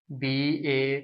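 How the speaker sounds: usual speaking voice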